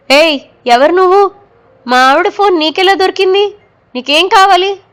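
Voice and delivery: frightened, fired off fast at the stranger; panicked. A narrator's reading. Husband confronting the stranger on his wife's phone, panicked. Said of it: "కంగారుగా అడిగాడు".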